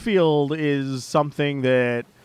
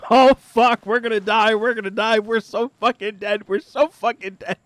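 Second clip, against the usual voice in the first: higher, louder hammed-up dread, nearly laughing; mock panic; 7 semitones higher, 4 dB louder than usual. Friend panicking as Blurbs closes in, higher hammed-up wail. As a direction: play a friend spiraling into doom. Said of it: "just fucking straight panic".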